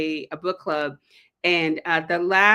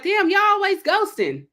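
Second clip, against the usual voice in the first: mock exasperation; 12 semitones higher, 4 dB louder than usual. Exasperated at being ghosted.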